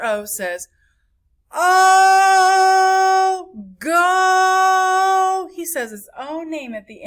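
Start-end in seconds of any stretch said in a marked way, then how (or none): 1.5-5.5 Oh and go held long on one high, loud note; long vowel. Shown as a demonstration. Teacher voicing Mister O, drawn-out long vowel. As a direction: sing out the long O in Oh, go. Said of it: "vowel says its own name".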